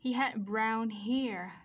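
deliberate teacher's read, drawn out; slow model sentence. Shown as a demonstration. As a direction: spell out each word slowly and firmly for learners. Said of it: "chúng ta có mẫu câu này".

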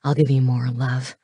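low-pitched lover, panting; low promise to a lover; panting male lover. Acted. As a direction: voice the man low, as if out of breath. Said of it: "he panted out between harsh thrusts".

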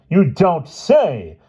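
high, loud, very drawn-out disdain; cold offence. A narrator's reading. Owner of the paper to doubting employee, coldly offended. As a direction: stretch it slowly with haughty coldness. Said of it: "said the owner of the paper coldly".

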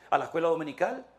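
ordinary talk

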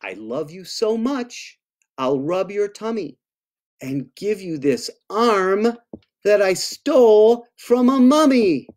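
storybook read-aloud; reading to children. Acted. Author reads a monster love poem to kids, storybook voice. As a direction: read it sweetly to little listeners.

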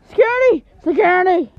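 high, loud yell for help; shouting for security. Acted. Boss yelling for security to throw him out. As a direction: call out for the guards in a panic.

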